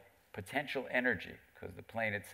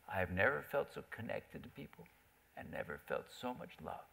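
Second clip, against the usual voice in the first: hushed confession; 7 dB quieter than usual. Retold or imitated. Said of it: "he gets really quiet".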